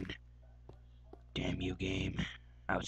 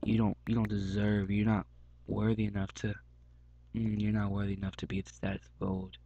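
ordinary talk

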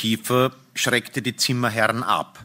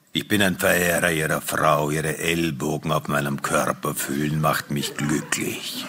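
low, smooth admirer's voice; stranger's deep voice; 10 semitones lower than usual. Acted. Admirer addressing the husband about his wife, deep stranger's voice. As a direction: speak as the unruffled admirer, deep down.

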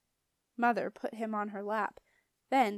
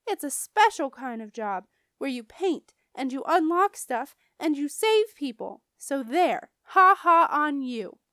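put-on loud, defiant kid comeback; very angry; 4 semitones higher, 8 dB louder than usual. A narrator's reading. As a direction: argue back crossly, ending in a gloat. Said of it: "I said, very angry".

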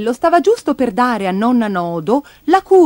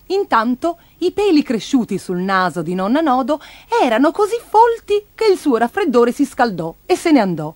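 usual speaking voice

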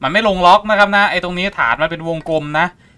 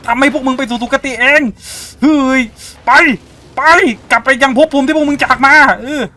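loud, higher hammed-up banishing of spirits; mock exorcist; 9 semitones higher, 6 dB louder than usual. Gamer pretending to exorcise ghosts, loud mock ritual. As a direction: play the fearless ghost-hunter sending spirits away.

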